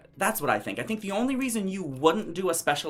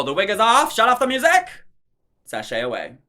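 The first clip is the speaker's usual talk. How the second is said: louder and higher, declaring the rule; emphatic rule; 3 semitones higher, 7 dB louder than usual. Real, not acted. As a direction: proclaim it like a ruling. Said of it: "that's my new rule".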